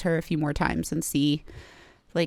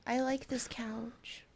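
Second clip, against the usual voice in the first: whispering; 4 semitones higher, 11 dB quieter, slower than usual. Real, not acted. Defending couch, whispered aside.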